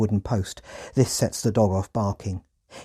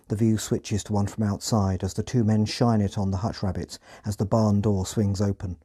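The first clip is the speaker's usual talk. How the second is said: ordinary delivery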